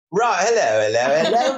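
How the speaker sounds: high, loud mock-British greeting; British accent. Guest imagines crashing his friend's show, high mock British accent. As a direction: play a hammed-up Brit bursting in with hellos. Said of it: "And I'll be British".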